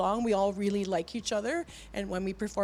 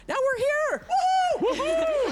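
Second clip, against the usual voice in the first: higher and louder, whooping; excited cheer; 6 dB louder, slower than usual. Real, not acted. Singer cheering her band's arrival, whooping. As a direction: whoop with joy.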